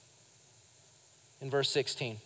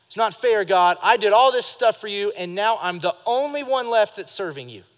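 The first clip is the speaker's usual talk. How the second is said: louder, aggrieved protest; complaining to God; 4 semitones higher, 6 dB louder than usual. Retold or imitated. Elijah protesting to God that it's not fair, louder and aggrieved. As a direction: push back at God like you've been wronged. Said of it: "he starts to complain to God".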